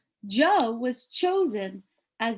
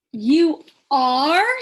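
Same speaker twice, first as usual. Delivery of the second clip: astonished; 5 semitones higher, 11 dB louder than usual. Acted. Child astonished.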